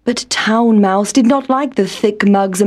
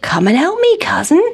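higher call with a rising end; 5 semitones higher than usual. A narrator's reading. Country Mouse asking his cousin for help, calling out. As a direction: call a cousin over, mildly raised pitch. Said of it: "called Country Mouse".

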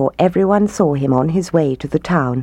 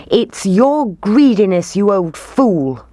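higher, accusing crowd voice; angry shout; 4 semitones higher, 3 dB louder than usual. A narrator's reading. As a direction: shout the blame at him. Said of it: "they shouted".

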